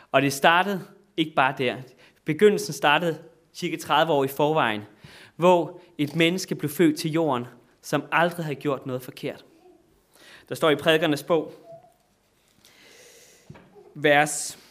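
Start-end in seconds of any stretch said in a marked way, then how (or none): none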